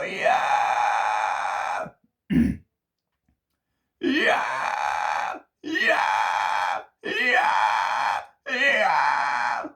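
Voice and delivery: belted yeah screams; screaming. Shown as a demonstration. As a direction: scream it full out. Vocalist testing screams.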